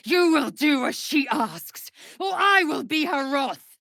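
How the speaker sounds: high loud shouted warning; furious threat. Acted. Warrior threatening a defiant ally, shouting in fury. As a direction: bellow the threat as her queen's wrath.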